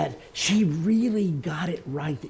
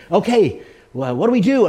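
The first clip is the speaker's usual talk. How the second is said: eager; 6 semitones higher, 10 dB louder than usual. Retold or imitated. Young woman eager, loud.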